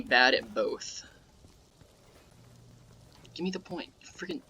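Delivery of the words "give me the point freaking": annoyed mutter under breath; muttered frustration. Real, not acted. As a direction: grumble quietly at the game. Game point not given, muttering.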